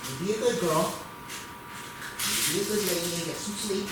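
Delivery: gentle goodnight to a pet; soft pet talk. Real, not acted. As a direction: tuck the dog in with your voice. Settling the dog for bed.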